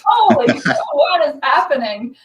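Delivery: loud, high, almost crying with excitement; stunned disbelief. Retold or imitated. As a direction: blurt it out, overwhelmed and thrilled. Retelling shock at being asked.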